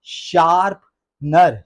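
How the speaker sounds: शार्पनर very slow, shaarp... nar; correct pronunciation. Shown as a demonstration. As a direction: stretch शार्पनर into two clear parts. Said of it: "Actually word क्या है जी?". (शार्पनर को बहुत धीरे, शार्प... नर)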